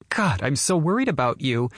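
high fussing mother voice. Acted. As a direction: gush with motherly worry.

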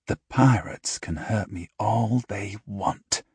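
soft, slow, determined vow; quiet vow. A narrator's reading. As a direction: swear it under your breath. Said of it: "he vowed".

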